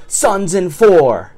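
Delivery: drawn-out yell, high and loud; imitated yell. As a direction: imitate a fan's slow, loud chant-like yell. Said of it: "and yells".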